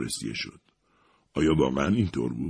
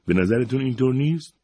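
pressing question, higher pitched; insistent; 7 semitones higher, 3 dB louder than usual. A narrator's reading. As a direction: push her point, pitch climbing. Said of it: "اصرار کرد".

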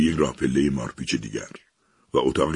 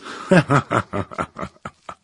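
high mocking machine cackle; loud cackle; 9 semitones higher than usual. The machine laughs at trapped Eliza, a high gloating cackle. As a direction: let out a long gloating laugh. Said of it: "صدا قهقهه زد".

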